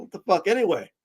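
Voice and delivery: ordinary talk, nothing marked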